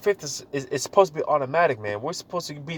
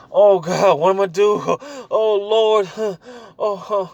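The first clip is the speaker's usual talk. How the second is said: high, loud, breathless mock panic; hammed-up alarm with gasping huffs; panicking; 6 semitones higher, 6 dB louder, slower than usual. New believer crying out to God in mock panic.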